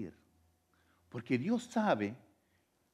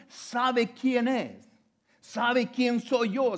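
rising preaching; 7 semitones higher, 4 dB louder than usual. Acted. Preacher telling how the blind man called to Jesus, building fervor. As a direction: build the blind man's story toward a cry.

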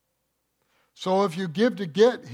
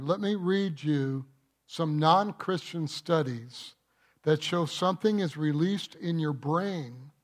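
ordinary talk

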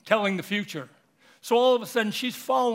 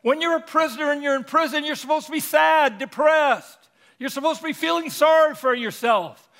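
preaching at full force; 7 semitones higher, 4 dB louder than usual. Real, not acted.

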